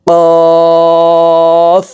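"কফ" said thick from the back of the tongue, held long on a high level tone; heavy, stretched qaf. Shown as a demonstration. Teacher demonstrating qaf, long heavy chanted hold. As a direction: draw out a heavy "কফ" as a steady note. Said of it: "কফ হরফ উচ্চারণ করব".

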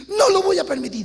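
high, loud tearful insistence; crying mother refusing to lose her son; pleading mother. Retold or imitated. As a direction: refuse to let him go, pitched high.

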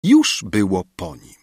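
plain reading voice

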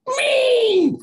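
loud high imitated pony squeal; mock horse whinny, loud. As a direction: let out a shrill pony cry. Horse noise imitated in story.

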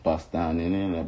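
normal talking voice

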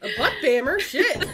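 loud laughing outburst; laughing disbelief. Real, not acted.

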